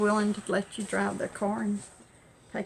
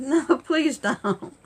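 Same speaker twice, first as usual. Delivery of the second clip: laughing plea; 5 semitones higher, 4 dB louder, slower than usual. Retold or imitated. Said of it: "She said".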